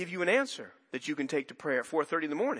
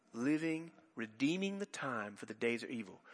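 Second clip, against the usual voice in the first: quiet verse; 5 semitones lower, 9 dB quieter than usual. Shown as a demonstration. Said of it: "you hear that word".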